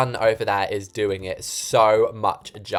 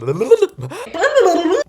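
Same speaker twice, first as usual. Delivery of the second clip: squealing high laugh at herself; 6 dB louder than usual. Real, not acted. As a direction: burst out laughing. Laughs at own slip.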